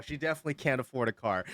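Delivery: ordinary talk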